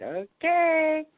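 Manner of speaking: high, drawn-out "okay"; doubtful acceptance. Retold or imitated. Healer humours insistent client, doubtful. Her doubtful reaction, retold.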